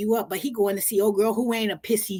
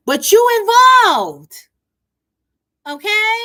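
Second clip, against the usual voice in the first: loud exclamation; 12 semitones higher, 13 dB louder than usual. Real, not acted. Commentator warns about drunk friend's fights, loud exclamation. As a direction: belt it out high and stretch the word. Mock exclamation at being dragged in.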